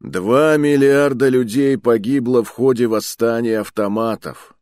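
loud booming speech, punching Два; booming orator. A narrator's reading. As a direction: boom it out like a seasoned lecturer. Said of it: "громогласно произнёс наставник".

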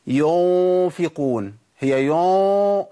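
"ينفقون" with a long, loud, rounded "yoon" hum; wrongly heavy ghunnah. Shown as a demonstration. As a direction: darken and stretch the ن of "ينفقون" like "يون". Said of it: "ما ينفعش إن أنا أقول". (غنة مفخمة خطأ في "ينفقون")

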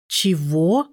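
drawn-out, disbelieving "Чего?"; stunned, emphatic rising question; incredulous repeat. A narrator's reading. Repeated, disbelieving reply on the phone.

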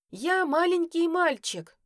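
high, loud, slow shouted protest; yell. A narrator's reading. Boy protesting to his sister, loud slow yell. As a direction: bawl it out like a scared little boy. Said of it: "заорал Матвей".

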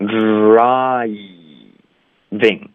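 之 啊 一 glided slowly, then a separate "ving"; drawn-out build-up. Shown as a demonstration. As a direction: sound out driving from 之 through 啊 and 一 to ving. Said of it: "我们咬到一个". (分解慢读 之、啊、一、ving)

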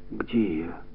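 weak, barely voiced whisper; hushed, dazed question; faint whisper. A narrator's reading. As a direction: whisper it as if just coming round. Fainted girl wakes, disoriented.

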